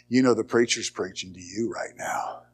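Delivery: soft breathy whisper, like a still small voice; quiet whisper. Shown as a demonstration. Preacher plays the Spirit's still small voice, whispered. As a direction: breathe the words gently, barely voiced. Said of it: "just quietly".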